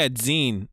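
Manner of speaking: "Zine" said "zeen"; long ee in "Zine", rhyming with seen; "Zine" as in magazine. Real, not acted. Streamer finds the answer, says "Zine" as "zeen". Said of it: "'Cause it's like a magazine".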